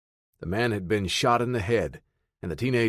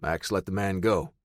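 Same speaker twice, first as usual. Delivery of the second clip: ordinary delivery, nothing marked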